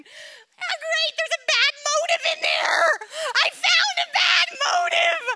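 high, loud, half-laughing wail of mock despair; hammed-up cry of doom, pitched high and loud; mock panic. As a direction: melodramatic alarm, playing up the horror. Mock panic over a bad motive.

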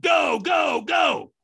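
high, loud shouted "go"; imitated trainer's shouted send-off; urgent shouted launch. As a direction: yell them off the starting line. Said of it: "screamed at the top of my".

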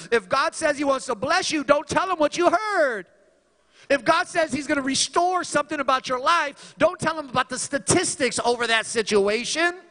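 high, loud exhortation; rising preacher's call; full-force preaching. Acted. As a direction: urge the congregation on.

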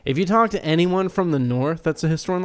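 normal talking voice

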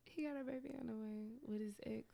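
dropped to a near-whisper; half-whispered. Real, not acted. Repeating gossip to the co-host, half-whispered. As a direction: confide it under your breath.

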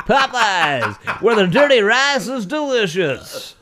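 announcer drifting into an ad; awkward baseball announcer. Retold or imitated. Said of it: "be like".

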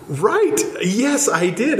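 high, eager "Right. Yes, I did"; suddenly remembering, thrilled; excited recall. Retold or imitated.

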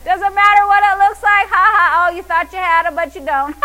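loud, high gloating, ending in a giggle; laughing taunt. Shown as a demonstration. Believer mocking the devil's failed scheme, laughing taunt. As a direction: taunt triumphantly and dissolve into laughter. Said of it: "A believer starts laughing".

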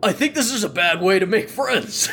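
character voice. Acted. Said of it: "looks up and goes".

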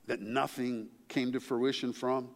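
usual speaking voice

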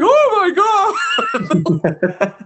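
half-laughing horrified shriek; horrified cry. Retold or imitated. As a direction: cry out in horror. Cricket horrified at flamethrower.

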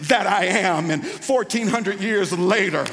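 loud, driving sermon delivery; full-force preaching. Real, not acted. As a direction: build the retold dialogue into a forceful sermon climax.

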